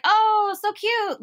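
high, mock-adoring coo; sugary high-pitched mockery; cooing over a pet. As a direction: imitate someone gushing at a cute pet. Mimics people treating her like pets.

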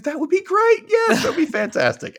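high, gushing yes-man voice; eager mock Howard.

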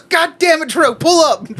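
high, loud exasperation; exasperated cashier. Retold or imitated. Cashier to the regular customer, high exasperated. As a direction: groan it out, fed up.

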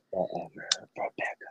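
low, hushed whisper; cologne commercial voice. Pretend. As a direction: whisper it like a perfume ad slogan. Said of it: "doing a cologne commercial".

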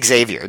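Xavier said as ig-ZAY-vee-er; Xavier as "ex-ZAY-vyer", as a correction; corrected pronunciation. Retold or imitated. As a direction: give Xavier its opening "ex" sound. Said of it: "Gabe could have been like".

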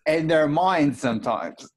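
high, slow mock British guru; Russell Brand voice. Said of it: "my Russell Brand voice".

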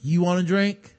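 mock-innocent sarcastic question; sarcastic heckle. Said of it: "must have said it sarcastically as heckle".

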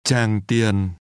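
"Tràng Tiền" with both syllables falling; two low falling tones. Shown as a demonstration. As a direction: let "Tràng" and "Tiền" each sink downward. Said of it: "pronounced with two low falling tones".

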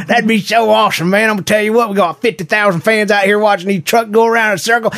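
put-on over-the-top Southern good ol' boy accent. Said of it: "That wasn't even a good accent".

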